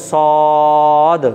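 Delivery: সদ with a very long, level round "o"; correct rounded pronunciation. Shown as a demonstration. Teacher leading students to repeat, correct rounded pronunciation. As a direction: stretch সদ slowly on round lips to the final d. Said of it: "উচ্চারণ করতে হবে".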